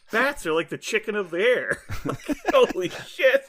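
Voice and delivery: high voice breaking into laughs; real laughter. Real, not acted. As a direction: crack up while telling the joke. Cracking up retelling a scene.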